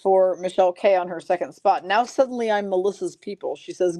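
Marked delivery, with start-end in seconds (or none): none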